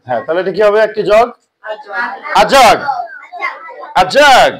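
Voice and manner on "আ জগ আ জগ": loud classroom shout. Shown as a demonstration. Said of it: "জোরে জোরে, জোরে জোরে".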